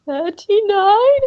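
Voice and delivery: high, slow, strained mock victim; struggling to speak. Stabbed man answering his attacker, acted struggle to speak. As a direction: squeeze it out slowly as if wounded. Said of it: "the guy's trying to say".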